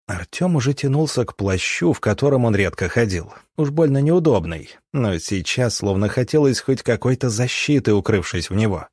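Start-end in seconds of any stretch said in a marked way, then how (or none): none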